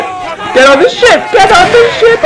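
rising pitch. Pretend. Gamer urging his crew aboard, high and urgent. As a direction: push "Get on the ship" up into a high urgent call. Order repeated, pitch climbing.

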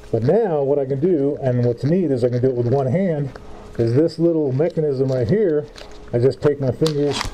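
muffled behind face mask; speaking through filter cartridges; through a respirator mask. Real, not acted. Seen 2: Half-face respirator covers mouth and nose throughout; dropped by 8.1.

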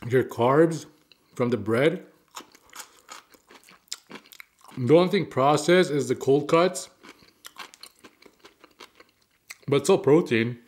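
licking fingers between words; chewing while talking. Real, not acted. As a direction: mouth full of food. Seen 0: Chews food throughout, licks fingers at 0.3-3.6, 6.2-7.5; cheeks full.

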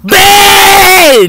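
high, loud wailing cry of the name; crying shout. Acted. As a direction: wail it like a hurt child. Pleading, dog refuses.

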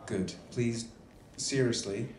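ordinary delivery

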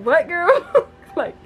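high, giggling disbelief; teasing a twin sister with laughter; laughing. Real, not acted. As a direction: laugh through the words.

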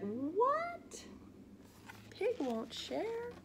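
very high, startled little dog; shocked Trevor. Acted. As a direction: squeak it in disbelief, drawn out. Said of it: "he's like".

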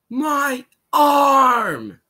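high, loud mock cry of pain; drawn-out, high-pitched yell; hammed-up pained shout. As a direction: holler it out as an acted scream. Cry within acted Engineer monologue.

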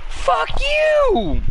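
shrill mock yell; high squealed yell. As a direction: squeal it out playfully. Playful outburst at friend.

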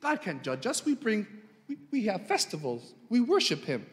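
a light touch of smug self-justifying people; smug Israelites. Acted. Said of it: "You can imagine the Israelites saying".

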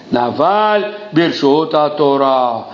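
drawn-out, slow, weighty recitation; very slow emphasis. Acted. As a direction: spell the saying out slowly. Quote repeated for emphasis.

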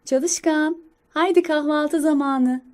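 a hint of a mother calling her child; mother squirrel. Mother squirrel waking her child for breakfast, lightly voiced motherly call. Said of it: "Anne sincap".

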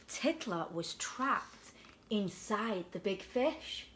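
put-on lively storybook voice for a child; bedtime story reading. A narrator's reading. Parent reading a picture book to children, lively. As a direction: read it like a bedtime picture book to a little one.

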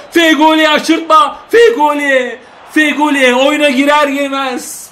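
formal commentator calling subs then rising to a shout; "sayın seyirciler" like a broadcaster, building to a yell; excited TV football call; live play-by-play. Acted. Commentator voice addressing the viewers.

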